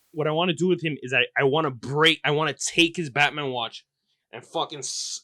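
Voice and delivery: ordinary talk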